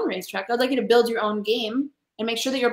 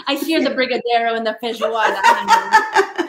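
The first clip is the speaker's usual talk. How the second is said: laughing; 8 dB louder than usual. Real, not acted. Guest teases host about Brazilian food, laughing. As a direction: giggle out the food names, then squeal honey.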